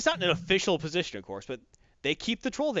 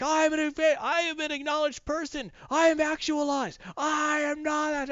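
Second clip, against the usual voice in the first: much higher, slowing into a shout; high troll voice building to a slow yell; hammed-up high troll rant ending in a shout; furious troll; 10 semitones higher than usual. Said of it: "And he's like".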